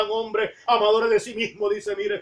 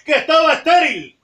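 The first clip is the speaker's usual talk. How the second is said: louder, higher, urging the congregation; fervent preaching shout; 4 semitones higher, 4 dB louder than usual. Real, not acted. Preacher exhorting the congregation, raised fervent shout. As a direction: preach it out with full force. Preacher reaches sermon climax.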